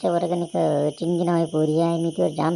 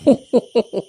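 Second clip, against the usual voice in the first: a sudden loud burst of laughter; 6 semitones higher, 8 dB louder than usual. Acted. Girl laughing out loud at the lovestruck classmate. Teasing banter between two classmates.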